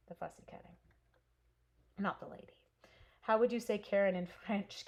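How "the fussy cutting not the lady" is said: soft whispered joke aside. Real, not acted. Joking aside to viewers about the lady, whispered. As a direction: drop to a whisper for the punchline.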